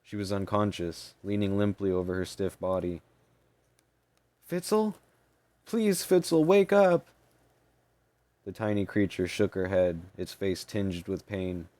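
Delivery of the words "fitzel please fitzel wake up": high, loud desperate plea; desperate pleading. Acted. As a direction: beg her to wake up. Maney begs unconscious fairy to wake.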